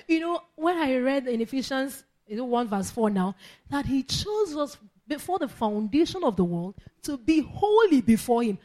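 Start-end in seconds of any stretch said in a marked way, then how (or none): none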